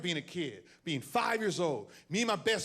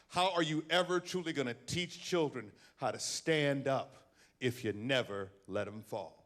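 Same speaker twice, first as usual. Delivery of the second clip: ordinary talk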